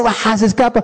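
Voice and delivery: normal talking voice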